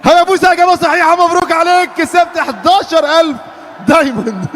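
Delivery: booming prize announcer. Real, not acted. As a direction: boom out the win like a game-show host. Host announces the win. (صوت مذيع جوائز عالي)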